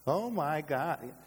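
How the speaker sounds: slow, drawn-out imitation of slang; exaggerated, lingering mock exclamation. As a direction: stretch each word, pitch lifted, mimicking careless talk. Mimics casual misuse of God's name.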